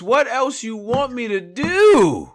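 slow, pleading [name] impression. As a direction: beg like [name], drawn out. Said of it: "[name] voice".

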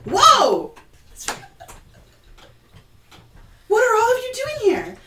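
sudden loud high alarmed cry, then a fast question; jolted out of meditation, shout it; meditating elephant woman startled by strangers, loud and high. Acted. Startled meditating Loxodon NPC.

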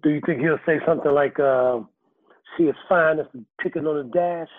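ordinary delivery

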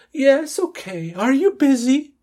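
high, drawn-out put-on female voice; woman's voice. Cindy greets Peter in the dialogue, high put-on woman's voice. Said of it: "la voz de mujer".

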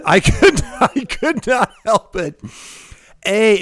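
high, squealing through laughter; cracking up while talking; helpless laughter. Real, not acted. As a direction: laugh so hard the words climb. Recalls losing it, laughing again.

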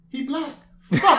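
high-pitched exasperation, bursting into a loud curse; retold mad outburst. Podcast guest retelling their reaction, mock mad. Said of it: "I was so mad".

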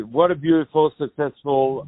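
ordinary talk

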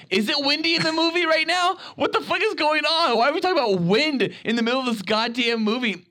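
high-pitched, incredulous rant; retold exasperation. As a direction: replay your baffled outrage at the chatterers. Retelling exasperated reaction in cinema.

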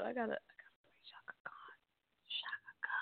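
fast excited whisper. Real, not acted. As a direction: whisper it to yourself, quickly. Excited aside about [name].

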